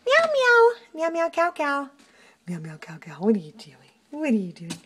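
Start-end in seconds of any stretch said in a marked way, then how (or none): 0.1-0.7 high cooing pet talk. Real, not acted. Cooing pet name at cat. 0.9-1.9 high cooing. Real, not acted. Higher take of cat's name. 2.5-3.1 soft cooing. Real, not acted. Softer repeat of cat's name. 3.2-3.8 breathy whisper at the cat. Real, not acted.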